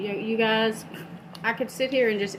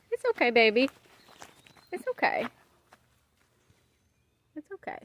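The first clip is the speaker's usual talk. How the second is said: soothing a scared dog; 3 semitones higher than usual. Real, not acted. Calming dog with raised hackles.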